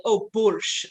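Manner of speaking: imitating a non-Russian's fake Russian accent; bad Russian accent. Podcaster mocking actors' Russian accents, bad Russian accent. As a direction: put on a mock Russian accent. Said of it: "does a Russian accent always does that".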